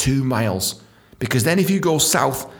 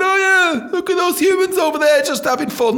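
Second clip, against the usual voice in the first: high, comic talking-shark voice; basking shark; 3 dB louder than usual. Acted. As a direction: play a casual shark spotting swimmers, pitched up. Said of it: "the basking shark's going".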